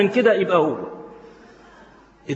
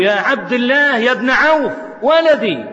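raised, higher calling voice; mother calling loudly; 5 semitones higher than usual. Acted. Mother calls her son Abdullah loudly, raised calling voice. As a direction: call out to the son across the house. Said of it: "نادت عليه أمه بصوت عالي".

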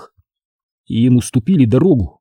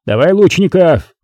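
raised-pitch battle command; barked order; 6 semitones higher, 3 dB louder than usual. A narrator's reading. Commander calling for archers, barking. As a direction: bark out an order. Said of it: "гаркнул Бобёр".

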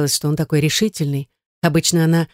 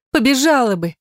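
higher, wailing plea; lamenting; 8 semitones higher than usual. A narrator's reading. Gayne assures David she would agree, lamenting. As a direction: wail it lightly, pleading. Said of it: "запричитала она".

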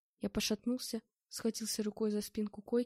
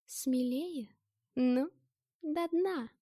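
higher, louder, teasing dare; egging on; 4 semitones higher, 4 dB louder, much slower than usual. Acted. Girl goading him to drink, louder teasing dare. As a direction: goad him to drain the glass. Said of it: "Подзадорила меня Маша".

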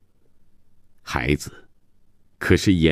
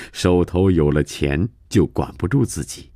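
ordinary delivery

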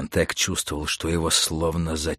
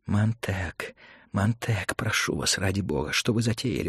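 soft, anxious plea; pleading; 6 dB quieter than usual. Acted. Mentor pleads with Montag in his ear, imploring. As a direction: beg him under your voice. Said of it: "умолял Фабер".

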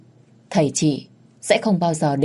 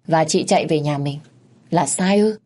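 plain reading voice

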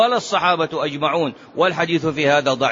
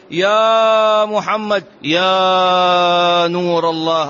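slow, drawn-out, loud pious call; mock devotional call; 4 dB louder, much slower than usual. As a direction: stretch it out like a fervent greeting. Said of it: "تسلم على رسول الله تقول". (نداء متضرع بطيء ممدود وعالٍ)

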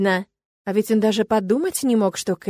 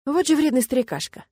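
ordinary delivery, nothing marked

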